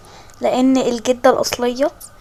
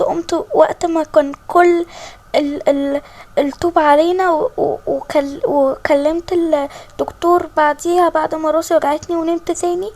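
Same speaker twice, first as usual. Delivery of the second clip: put-on higher, halting, anxious young woman; nervous young girl; 4 semitones higher than usual. Girl nervously tells the queen about the accident, halting and high. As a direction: stumble through the memory, scared.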